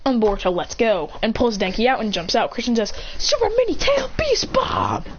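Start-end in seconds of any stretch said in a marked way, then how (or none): 3.2-5.0 high, slow battle-move call; high, drawn-out attack name. Retold or imitated. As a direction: call out a special move like an anime hero. Calling out a special attack.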